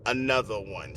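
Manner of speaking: low, quiet catchphrase drop. Retold or imitated. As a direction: intone it deep and soft. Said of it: "You know what he said?".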